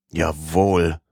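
a lightly voiced grumble of agreement; grudging, half-swallowed yes; grumbling. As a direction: servant grumbling agreement at the priests, mildly. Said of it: "brummte Passepartout".

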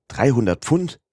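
startled, rising exclamation; exclaimed question. A narrator's reading. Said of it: "Rief Passepartout".

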